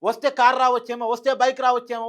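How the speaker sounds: usual speaking voice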